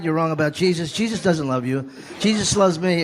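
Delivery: ordinary talk, nothing marked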